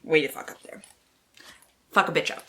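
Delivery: ordinary talk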